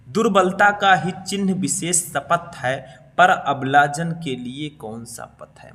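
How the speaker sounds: slow, loud verse reading; poem recitation. Acted. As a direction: declaim the couplet slowly and strongly. Reads the verse again. (ऊँची आवाज़ में धीमा कविता पाठ)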